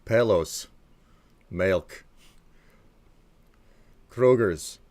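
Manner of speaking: isolated words in exaggerated Detroit accent; Detroit accent demo. Shown as a demonstration. Streamer showing how Detroit talks, in a mock Detroit accent. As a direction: say each word alone with hard Detroit vowels. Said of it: "keep doing the Canadian accent, but you make".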